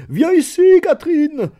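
high, loud summons across the house; loud call. A narrator's reading. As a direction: baron still laughing, calling his wife in, loud call. Husband calls wife in, laughing.

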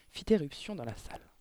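ordinary delivery

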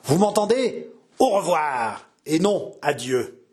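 menacing farewell, opening high; growled threat. A narrator's reading. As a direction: warn that this is not over, slowing at the end. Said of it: "gronda-t-il".

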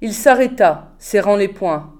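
ordinary delivery

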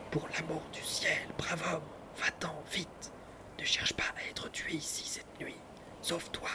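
urgent hushed warning whisper. A narrator's reading. Neighbours warning Andreuccio to flee the ruffian, urgent whisper. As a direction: warn him under breath. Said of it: "à mi-voix chuchotèrent".

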